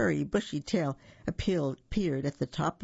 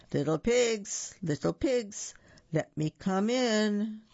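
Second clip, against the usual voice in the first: lightly voiced wolf, slow and higher; booming, barky wolf; 6 semitones higher, slower than usual. A narrator's reading. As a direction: draw out the wolf's call. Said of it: "booming, barky sort of voice shouted from outside".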